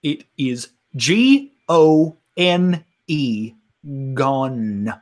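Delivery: G O N E spelled letter by letter, slow and emphatic; spelled out slowly. Acted. Miss Lavender insisting to the group, slowly spelling G O N E. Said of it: "I didn't stutter".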